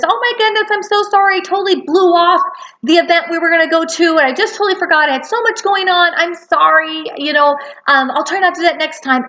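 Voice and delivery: over-apologize like a scattered friend; a friend who forgot the event, apologizing in a fluster; gushing apology. Acted.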